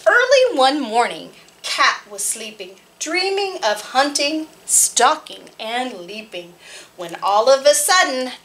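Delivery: put-on lively read-aloud voice for small children; storytime narration. Librarian reading a farm picture book to children, lively.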